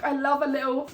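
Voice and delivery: ordinary talk, nothing marked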